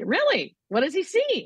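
high, eager surprised question; surprised interest. Retold or imitated. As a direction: sound genuinely curious and amazed. Surprised nurse asking wife.

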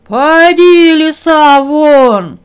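very loud roaring order; bear's shout. Acted. Bear yells the fox out of the hut, very loud. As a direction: roar the fox out. Bull roars.

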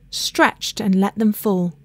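usual speaking voice

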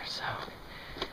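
conspiratorial adventurous whisper. Acted. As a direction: whisper it like a secret plan. Conspiratorial aside before tunnel.

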